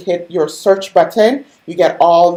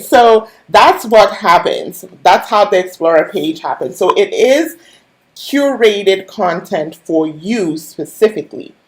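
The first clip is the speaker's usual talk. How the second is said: usual speaking voice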